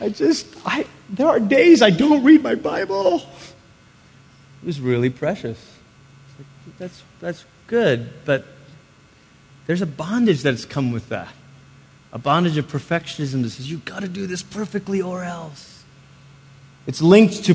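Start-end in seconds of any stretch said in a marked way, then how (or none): 0.0-3.2 put-on high, strained young man's voice; hammed-up tearful high pitch; mock anguished confession. 13.7-15.8 much softer, menacing undertone; mock ominous, held-back voice; hushed threat.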